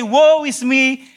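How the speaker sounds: preacher contrasting true dread, loud and slow; terrified cry. Retold or imitated. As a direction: cry out in real terror. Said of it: "he is terrified".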